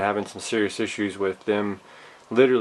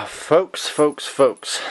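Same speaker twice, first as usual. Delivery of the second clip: calling out; 6 semitones higher, 4 dB louder, much slower than usual. Real, not acted. Calling out to viewers.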